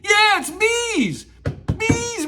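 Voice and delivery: high, slow, excited reveal. Real, not acted. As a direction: announce it with delight. Answers kids excitedly.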